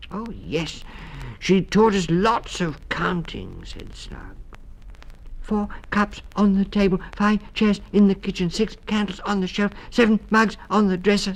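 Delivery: put-on high, eager child voice. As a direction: rattle off like a small child.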